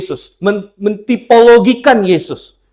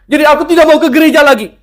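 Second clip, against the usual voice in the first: sulky refusal; 10 semitones higher, 6 dB louder, much faster than usual. Retold or imitated. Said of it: "Ngambek sama Tuhan".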